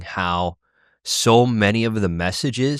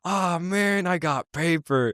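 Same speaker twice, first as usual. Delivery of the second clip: slow, softer, high-pitched letdown; mock disappointment; 8 semitones higher, 5 dB quieter, much slower than usual. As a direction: play the kid who just lost, dragging it out. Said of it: "the other guy's like".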